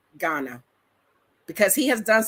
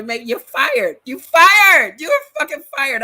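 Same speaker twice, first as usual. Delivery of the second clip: loud, high shout of you're fired, building; angry firing retort; 8 semitones higher, 10 dB louder than usual. Retold or imitated. Speaker shouting you're fired at the protector. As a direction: yell it at them, fed up with being watched.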